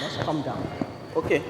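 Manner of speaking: ordinary talk, nothing marked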